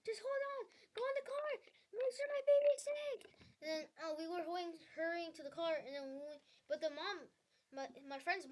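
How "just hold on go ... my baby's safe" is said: much higher-pitched panicky mom imitation; freaking-out mom. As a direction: act out a frantic parent on the phone, voice shooting up. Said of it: "my mom was freaking out on the call".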